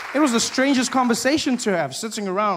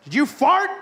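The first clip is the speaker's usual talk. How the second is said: accusing shout; 7 semitones higher, 4 dB louder than usual. Retold or imitated. America confronts Iran.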